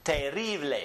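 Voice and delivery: terrible as teh-RREE-bleh; Spanish pronunciation. Shown as a demonstration. Teacher models a cognate for repetition, terrible as teh-RREE-bleh. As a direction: stress the RRI in Spanish terrible. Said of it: "This one is pronounced".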